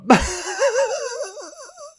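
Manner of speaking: high, wailing sob, acted out; long high boo-hoo wail; mock proper crying. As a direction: imitate a big blubbering cry. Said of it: "Yeah, proper".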